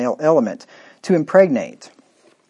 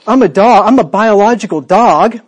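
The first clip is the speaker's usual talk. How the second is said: louder, higher put-on voice; deluded fool; 5 semitones higher, 6 dB louder than usual. Preacher mocking a deluded fool in a loud put-on voice. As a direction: ham up a man insisting he is a dog. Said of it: "and says".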